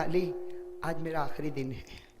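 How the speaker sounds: soft, fading farewell words; quietly voicing Fatima's farewell to Ali; faint dying voice. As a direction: let the voice sink, barely above a breath.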